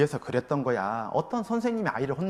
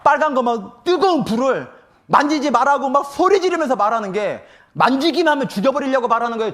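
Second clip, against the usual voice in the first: louder, higher preaching push; raised sermon voice; fervent preaching; 8 semitones higher, 7 dB louder than usual. Acted. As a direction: drive the point home to the congregation. Preaching, mother yelling analogy.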